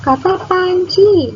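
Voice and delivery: high, slow reveal; bright reveal. Shown as a demonstration. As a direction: announce the answer slowly. Reveals the answer word.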